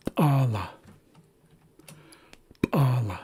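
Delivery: "p'ala" with an ejective, popping p; popped P. Shown as a demonstration. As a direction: pop the p, then release "ala". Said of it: "this is more of a popped sound".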